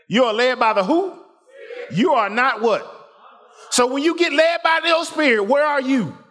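high, fervent preaching climb. Acted. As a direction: build the sermon to a peak. Preaching rises again.

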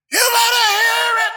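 high, loud shout from the pew; hollering churchgoer. Retold or imitated. Front-row churchgoer hollering at the preacher, loud shout. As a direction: yell it at the preacher. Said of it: "the one that was hollering out".

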